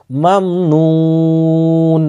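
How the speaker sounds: মামনুন said very slowly with the final নুন held still; stopping on the nun. Shown as a demonstration. Teacher showing a pause on the word, slow and held. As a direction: stop on মামনুন and rest the নুন. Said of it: "এই নুনটি সাকিন হয়ে যেত".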